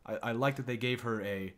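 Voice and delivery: usual speaking voice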